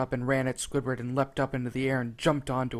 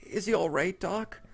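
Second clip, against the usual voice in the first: nervous; 6 semitones higher than usual. A narrator's reading. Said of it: "trying not to stutter".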